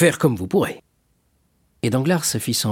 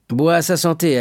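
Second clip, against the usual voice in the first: hearty urging; 8 semitones higher, 5 dB louder than usual. Acted. Danglars urges the drunkard to drink.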